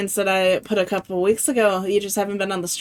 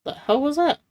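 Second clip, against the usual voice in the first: startled; 7 semitones higher, 3 dB louder than usual. Real, not acted. Sudden sound alert startles streamer.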